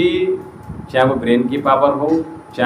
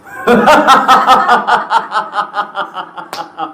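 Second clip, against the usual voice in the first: rhythmic high ha-ha laughter; demo laugh; 8 semitones higher than usual. Shown as a demonstration. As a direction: burst into a long, bouncing belly laugh. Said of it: "चलो एक बार।". (ठहाकेदार हंसी)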